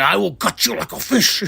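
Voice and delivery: mock threat, put-on voice; hammed-up villain menace; imitating Ricochet. Wrestler with scissors threatening his rival, mock menace. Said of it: "he's like".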